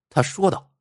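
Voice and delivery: plain reading voice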